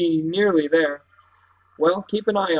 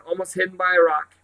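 ordinary delivery

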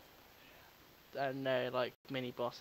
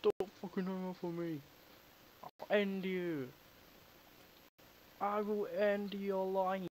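menacing caller; 5 semitones higher, slower than usual. Pretend. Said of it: "You could be like".

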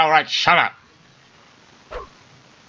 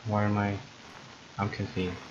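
soft low mumble; too quiet; 5 semitones lower, 14 dB quieter than usual. Real, not acted. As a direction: say it under your breath. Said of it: "you gotta be louder".

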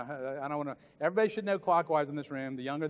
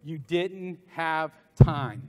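very slow, louder, "didnt" hit hard; slow emphatic; 6 dB louder, much slower than usual. Shown as a demonstration. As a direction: drag out each word of "you didn't have time". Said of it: "you cannot say".

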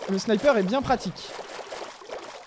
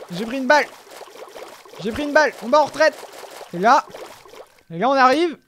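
loud, urgent gamer calls; excited; 4 semitones higher, 6 dB louder than usual. Real, not acted. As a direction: push through, heated and loud. Fighting under fire. (appels pressants et forts)

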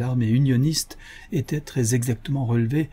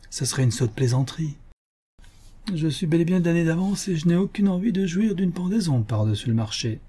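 higher, forced playful tone; strained mock lightness; forced banter; 7 semitones higher than usual. A narrator's reading. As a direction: joke along without meaning it. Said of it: "d'un ton badin, mais forcé".

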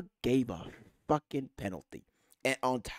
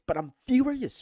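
real exasperation, higher pitched; furious; 7 semitones higher, 4 dB louder than usual. Real, not acted. Fan venting to viewers about his losing team, furious. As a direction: let the anger push the pitch up. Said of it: "I am fucking furious".

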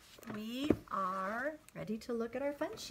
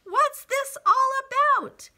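high, loud storybook shout; crowd shouting; 17 dB louder, faster than usual. A narrator's reading. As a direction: call out in bewilderment. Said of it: "the people came shouting".

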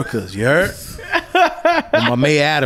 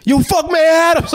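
put-on a thirteen-year-old faking a big voice; kid yelling with mad bass; 13 semitones higher than usual. Imitating the kid yelling at the mayor. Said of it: "he said that with mad bass in his voice".

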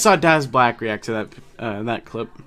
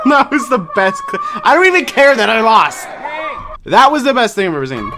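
loud laughing excitement; excited shouting; 6 semitones higher, 4 dB louder than usual. Real, not acted. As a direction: shout with joy. Delighted after losing the challenge.

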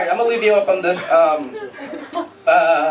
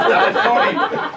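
slow, high, mocking hipster drawl; stretched-out mocking foodie voice; 7 semitones higher, slower than usual. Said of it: "You ever hear this?".

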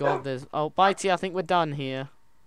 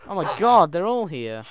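loud, high burst of surprise; astonished; 6 semitones higher, 11 dB louder than usual. Real, not acted. Player suddenly finds all the wolves, astonished. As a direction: exclaim it big, then ease off slightly.

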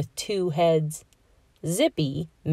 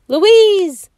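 high, loud shout of her name; 9 dB louder than usual. A narrator's reading. Robbie calling his sister through the window, loud. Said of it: "he shouted".

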